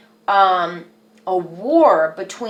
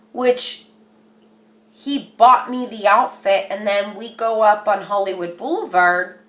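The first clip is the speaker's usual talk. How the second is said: ordinary talk, nothing marked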